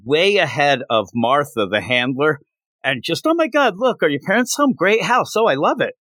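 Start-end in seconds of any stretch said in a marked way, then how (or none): 3.3-5.9 high, excited gushing; mock giddy girl storming in. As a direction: burst in squealing with delight.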